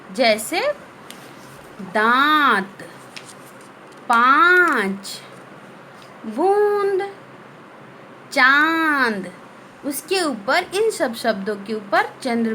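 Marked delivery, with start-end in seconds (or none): 1.8-9.3 दांत, पाँच, बूंद, चांद drawn out slowly, the nasal vowels held; slow nasal demo. Shown as a demonstration. Teacher lists chandrabindu words for the class, slow and nasal. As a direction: model each word slowly. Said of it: "और भी ढेर सारे इसके शब्द हैं".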